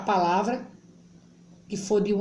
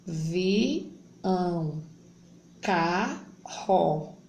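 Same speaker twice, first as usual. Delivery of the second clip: Avião stretched out syllable by syllable; teacher sounding out a word; slow reading aloud; much slower than usual. Shown as a demonstration. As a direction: draw out Avião slowly for the class. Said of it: "Vamos ler primeiro".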